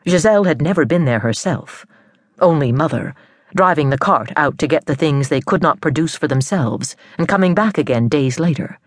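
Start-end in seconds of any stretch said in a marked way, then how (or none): none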